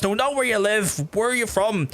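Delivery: mock curious taxi driver; quizzical taxi driver. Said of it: "folks are like".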